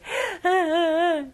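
a short loud mock sob; loud sob demo. Shown as a demonstration. Teacher demonstrates a loud sob for the class, hammed up. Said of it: "sob 같은 경우는 큰 소리로".

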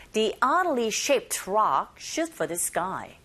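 slow model with "oddly" as AH-dly; teacher slowly models it for practice; slow drill line. Shown as a demonstration. Teacher drills the sentence slowly for listeners to repeat. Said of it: "listening one".

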